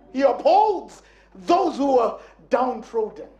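ordinary talk, nothing marked

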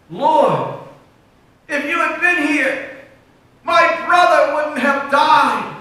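high, loud, slow plea of grief; Mary pleading with Jesus at his feet, a loud grieving cry. Acted.

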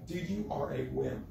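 imitating a tough dad, very low and soft; low-key put-down. Preacher imitating his dad dismissing a crying son, low and quiet. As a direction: drop to a quiet, deep dismissal.

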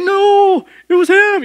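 high, loud whine blaming the brother; whiny tattling little sibling. Retold or imitated. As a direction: yelp the blame like a caught kid. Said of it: "It's like".

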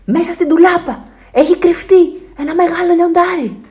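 high, loud alarm call; child shouting. A narrator's reading. Alfons reports a lion in the wardrobe, shouting. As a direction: raise the alarm to Dad. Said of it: "φωνάζει ο Άλφονς".

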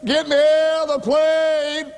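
high, long-held scream; wailing cry, each phrase stretched out high; hollering for help. Retold or imitated. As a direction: re-enact a trapped man's desperate holler. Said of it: "and he hollered".

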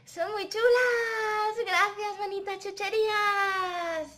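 put-on high, slow, loud little-girl puppet voice; delighted Caperucita. Caperucita thanking the pretty treats, delighted. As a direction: draw out the delight in a high character voice.

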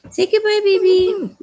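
high-pitched cooing to a puppy. Real, not acted. Coaxing the crying puppy.

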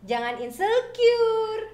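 slow, high smiling insecure. Shown as a demonstration. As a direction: stretch insecure with a smile. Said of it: "Tirukan ya".

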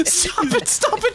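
high, drawn-out laughing plea; laughing panic. Real, not acted. Player swamped by enemy bullets, laughing panic. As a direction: beg it to stop through laughter.

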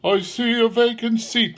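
high-pitched, solemn spirit voice; ghost. A narrator's reading. Ghost foretells the child's fate to Scrooge, solemn. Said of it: "replied the ghost".

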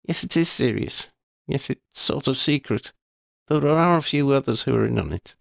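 put-on girl's voice, amused then serious; Lydia, wry schoolgirl. A narrator's reading. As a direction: tease her friend, then own up frankly.

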